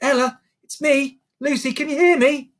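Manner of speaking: put-on high girl's voice, urging; Lucy, urgent. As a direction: coax a sleeping friend awake. Lucy rousing sleeping friend.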